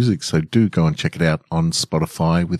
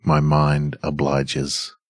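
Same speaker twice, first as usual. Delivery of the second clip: story narration; 2 semitones lower than usual. A narrator's reading. Calm relaxing story reading.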